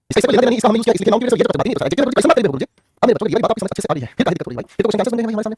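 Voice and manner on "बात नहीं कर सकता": ordinary talk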